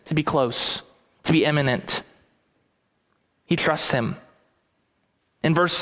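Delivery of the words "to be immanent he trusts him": ordinary talk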